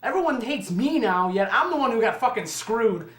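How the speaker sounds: usual speaking voice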